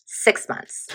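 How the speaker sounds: unvoiced whisper to the deck. Real, not acted. Whispering the time frame to the tarot deck. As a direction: breathe the words to the cards.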